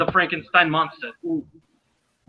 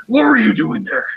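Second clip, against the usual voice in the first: menacing interrogator, a bit higher and louder; intimidating voice; 5 semitones higher, 3 dB louder than usual. Acted. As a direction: lean in and demand it like a tough cop. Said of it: "I need to do the intimidating voice".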